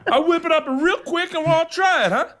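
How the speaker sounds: very high, hammed-up TV cook voice; chef character voice. Comedian mimics the cook announcing pork tenderloin, character voice. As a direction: put on a high-pitched cook pitching a dish. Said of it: "Here's the voice again".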